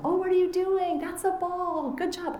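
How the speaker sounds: put-on high sing-song voice for a toddler; baby talk. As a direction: coo like a parent praising a tot. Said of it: "the parent is talking to them".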